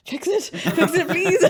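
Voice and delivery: high, slow begging through laughter; pleading. Retold or imitated. Barbarian begging a mender to fix it, high laughing plea. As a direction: whine it out, laughing.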